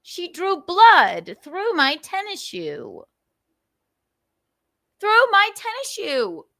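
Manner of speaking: high, loud indignant protest; comic outrage. Real, not acted. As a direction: wail the grievance, pitch climbing. Indignant complaint about cat bite.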